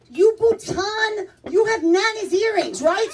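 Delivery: high, drawn-out mock-angry accusation; pissed-off mom. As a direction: imitate mom snapping in outrage. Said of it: "So now my mom gets pissed".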